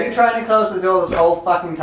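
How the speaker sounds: fast, incredulous telling-off; exasperated yell. Acted. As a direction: have a half-laughing go at a teammate. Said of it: "And I yell at Purdy".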